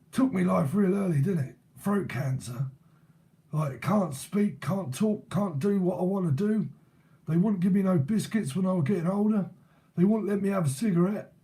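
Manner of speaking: put-on cockney gaffer's moan; imitated cockney. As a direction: act out a complaining London old geezer. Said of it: "trying to act it out".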